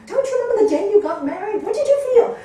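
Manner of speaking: high, loud and fast, full of urgency; pleading question. Retold or imitated. Teacher retelling her plea to a divorcing couple, high and loud. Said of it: "they go".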